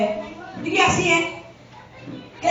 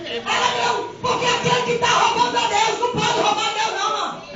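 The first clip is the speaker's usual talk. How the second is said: raised preaching; 8 semitones higher than usual. Acted. Preaching against tithe thieves.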